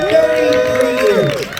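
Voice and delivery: married man crying out thirty-three years; mock anguished repeat. Shown as a demonstration.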